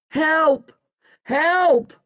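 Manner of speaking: high cries for help; high-pitched storybook shout. A narrator's reading. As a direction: call out high and urgently for rescue. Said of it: "I shout".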